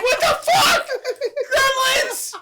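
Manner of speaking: very high, loud shriek; hammed-up horror, half laughing; mock panicked yell. Voicing a man seeing gremlins invade, mock panicked shriek.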